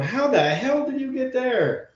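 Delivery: high, drawn-out cry of disbelief; incredulous. Retold or imitated. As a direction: wail it slowly, reliving the astonishment. Acted incredulous reaction to a score.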